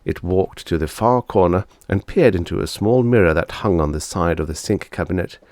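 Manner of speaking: usual speaking voice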